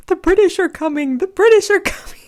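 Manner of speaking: high, loud kid playing Paul Revere; son's mock alarm cry. Son yelling a warning at the TV, high and loud. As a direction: shout the warning like a boy at play. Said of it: "he's like".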